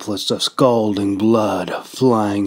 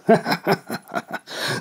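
high unvoiced scoff of a laugh; breathy laugh; 10 semitones higher, 3 dB quieter than usual. A narrator's reading. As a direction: laugh it off darkly. Captive laughs at captor.

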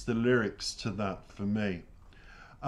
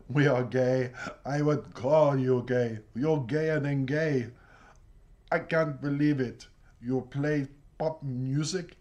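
imitating Italian partner; 2 semitones higher, 3 dB louder than usual. Partner mocking the narrator's pop music taste, in an Italian accent. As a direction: tease in a hammed-up Italian accent. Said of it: "he goes".

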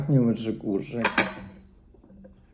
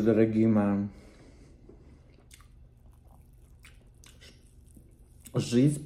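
speaking between bites; talking while chewing. Real, not acted. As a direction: mouth full of fish. Seen 0: Bites fish at 0.8–3.0, then chews while talking through 8.4.

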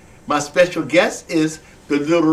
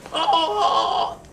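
hen clucking; 11 semitones higher, 3 dB quieter than usual. Pretend. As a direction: cluck away like a talkative hen. Hen puppet clucking reply.

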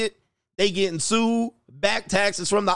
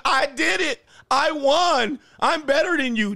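high-pitched mimicry of a boastful woman; gloating, pitched up in imitation; mock bragging winner; 8 semitones higher than usual. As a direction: crow it in a mocking higher voice. Mimics women bragging after winning.

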